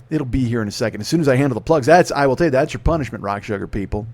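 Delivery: ordinary delivery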